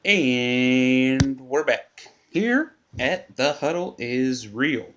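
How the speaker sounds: slow, loud show-return announcement; radio host announcer. Acted. Host welcoming listeners back after the break, big slow announcer voice.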